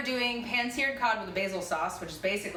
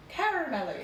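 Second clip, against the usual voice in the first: mock Irish accent; 7 semitones higher than usual. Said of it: "with an Irish accent".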